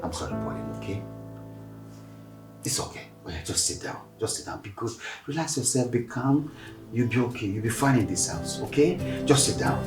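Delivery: soft reassuring hush; calm her down gently; father softly comforting his crying daughter; soothing. Acted.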